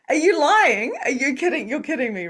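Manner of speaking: high, loud disbelief, retold; incredulous. Reader reacting to a client confirming the uncle, incredulous. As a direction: fire off disbelieving questions, starting high and loud. Retold shock at an accurate reading.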